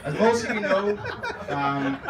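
can't keep a straight face; laughing. Real, not acted. First try, laughing.

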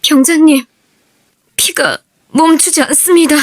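urgent report. Acted. Soldier reports bleeding comrade.

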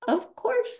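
Christopher Robin. Acted. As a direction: lift it way up for the boy. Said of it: "said Christopher Robin".

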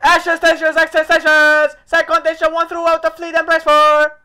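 loud commanding alarm call; alarm shout. Acted. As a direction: bark it like a ship's captain. Shouting the ship alarm card.

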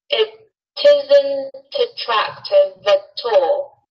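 "isn't attractive at all" linked, said very slowly; slow it right down, linking each word; slow practice. Shown as a demonstration. Teacher demonstrating linking for students, very slowly. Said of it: "自己先慢一点练".

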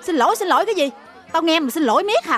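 cross boss berating the help; scolding. Acted. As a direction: scold a clumsy housemaid.

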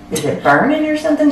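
high, careful mock mom voice; tentative. Mom probing about the oven, tentative. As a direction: ask innocently, pitched well up.

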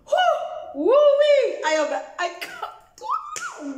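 high squealing, lost for words; overwhelmed squeal. Pretend. Vlogger overwhelmed by the story, high squealing. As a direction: squeal it out, speechless.

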